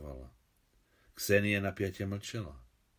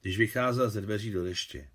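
ordinary delivery

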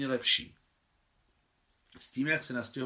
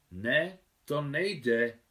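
very slow, repeated like a spell; like an incantation; 5 semitones higher, much slower than usual. Acted. Hadžibek repeats his refusal slowly, like an incantation. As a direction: chant it slowly like a mantra. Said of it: "opakoval jako zaklínadlo".

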